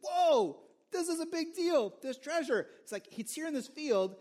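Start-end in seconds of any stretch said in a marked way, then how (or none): 0.0-2.7 higher, awed exclamation; acting out a man's thrilled surprise; amazed discovery. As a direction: marvel at the find. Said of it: "and he's like".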